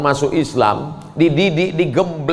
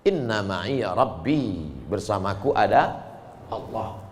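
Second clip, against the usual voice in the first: calm reassurance; 8 semitones lower, 7 dB quieter, slower than usual. Acted. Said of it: "Apa kata Musa?".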